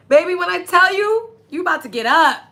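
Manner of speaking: loud, high-pitched hyping up; thrilled, laughing lead-in to a high cheer; excited hype. Real, not acted. Hyping up the listener's coming success.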